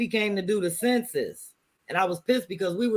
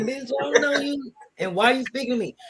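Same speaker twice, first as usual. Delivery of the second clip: higher, imitated suspicious neighbor; mock rebuff; 7 semitones higher than usual. City neighbor snubbing a friendly greeting, mock offended. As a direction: act out an offended stranger's rebuff.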